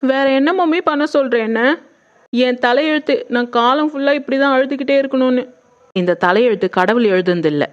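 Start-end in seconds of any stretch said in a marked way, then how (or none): 0.0-5.4 tearful despair. Acted. Daughter despairs to her mother.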